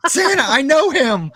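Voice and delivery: high, drawn-out gleeful cry; mock thrilled. Acting out someone spotting Santa, mock thrilled. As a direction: squeal it in overjoyed recognition. Excited Santa sighting quote.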